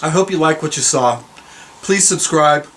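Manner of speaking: ordinary talk, nothing marked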